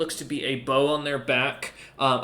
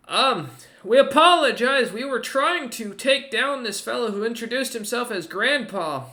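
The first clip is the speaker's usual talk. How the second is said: ogre's voice; 6 semitones higher than usual. Acted. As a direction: speak down from the wall, wary but polite. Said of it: "the ogre kinda looks back again and goes".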